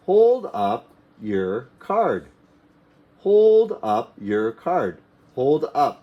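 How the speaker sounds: "Hold up your card" stretched out; slow drill. Shown as a demonstration. Teacher modelling the phrase for learners, slowly. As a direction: say it slowly word by word. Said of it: "slow drilled repetition".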